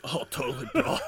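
high, hammed-up surfer dude. Co-host parodies the surfer voice, high and silly. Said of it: "Oh, no, the surfer voice".

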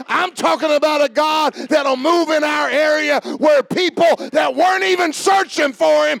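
high, loud, shouted sermon climax; full-force preaching. Real, not acted. Preacher proclaims a Savior who seeks and saves, full-force preaching. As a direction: drive each phrase out at a shout, stretching key words.

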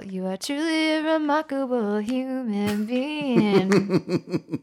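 slow, overly earnest tribute; mock solemn praise. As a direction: lay the praise on slowly and thick.

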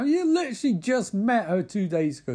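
high-pitched indignant protest; acted indignant outburst; heated retort. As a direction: snap back in exaggerated outrage. Acted quarrel in recap.

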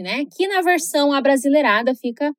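usual speaking voice

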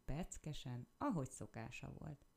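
usual speaking voice

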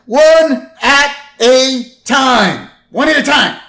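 high, loud, exasperated outburst; Marge. Retold or imitated. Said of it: "Marge is like".

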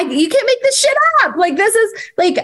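very high, quick exclamation; amazed, high-pitched outburst; real amazement. Real, not acted. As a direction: marvel at it in a soaring high voice. Marvelling at the story just told.

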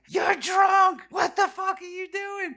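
very high, shrieked mock-mom yell; hammed-up screaming scolding. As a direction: screech it like an outraged mother. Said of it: "she's screaming at me".

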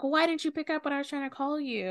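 high, fast worried-mother complaint; retold reproach. Retold mother reproaching son for missed calls.

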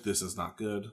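quiet foreboding aside; ominous aside. Retold or imitated. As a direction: drop the voice, dread it. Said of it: "And I'm like".

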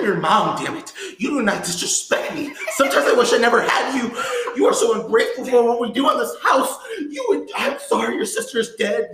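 loud, high, furious mother's tirade; Toni Collette. Acted. Mother rages at her son in Hereditary, hammed-up furious shout. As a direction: shout it as a hammed-up movie monologue. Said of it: "that's the way she was acting in the movie".

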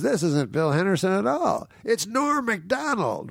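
high, mock-grand announcement; imitated host's reveal. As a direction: build to a high, stretched-out name. Host revealing the real guest.

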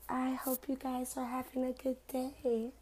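soft, hushed mock-seductive tone. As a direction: keep the sultry murmur going. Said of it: "from my seductive voice".

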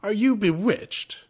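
high, loud and slow; amazed question. A narrator's reading. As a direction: ask in disbelief.

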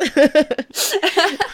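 high, loud burst of laughter. Real, not acted. Cohost cracking up at her own joke, loud laugh. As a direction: crack up loudly.

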